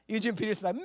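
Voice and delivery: ordinary talk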